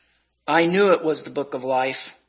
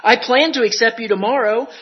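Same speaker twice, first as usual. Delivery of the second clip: crying out; 7 semitones higher, 7 dB louder than usual. Acted. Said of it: "crying to him".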